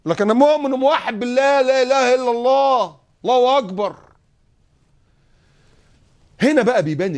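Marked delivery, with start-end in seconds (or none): 0.1-4.0 high, loud, slow sarcastic piety; mock pious proclamation. The hypocrite loudly proclaiming his faith. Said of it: "وده ايه ده".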